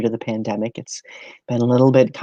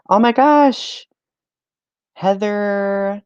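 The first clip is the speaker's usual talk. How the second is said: high, drawn-out happy squeal of recognition; thrilled, high-pitched hello; delighted greeting; 9 semitones higher, slower than usual. Real, not acted. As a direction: greet arriving friends, high and stretched out. Greets friends arriving in the stream.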